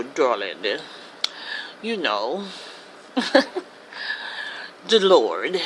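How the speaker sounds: a lightly affected, low, drawn-out darling; mock posh drawl. Shown as a demonstration. Preacher shows his audience the fake educated voice, mocking. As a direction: put on a snooty doctor's airs. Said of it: "sound like this".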